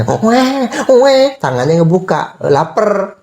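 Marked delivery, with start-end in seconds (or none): 0.0-1.4 baby crying imitation. Father imitating a hungry baby crying, high mock wail. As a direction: squeal like a hungry newborn. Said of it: "Lihat kalau nangis tangannya".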